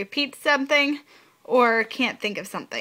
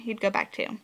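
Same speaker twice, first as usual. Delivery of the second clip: usual speaking voice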